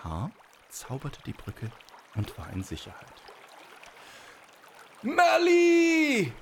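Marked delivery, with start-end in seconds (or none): 5.0-6.3 long, very high bellowed name; giant calling out. A narrator's reading. Giant yells after the escaped girl, bellowing high. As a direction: yell the name across a river, drawn out and high. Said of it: "rief der Riese".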